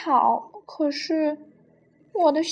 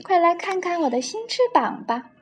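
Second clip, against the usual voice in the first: higher, lightly excited story voice; excited calling out; 8 semitones higher, 5 dB louder than usual. A narrator's reading. Penguin showing off new wings to a friend, excited call. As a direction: call proudly, without really shouting. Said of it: "他兴奋地喊着".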